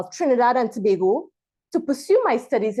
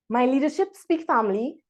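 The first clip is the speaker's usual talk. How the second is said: usual speaking voice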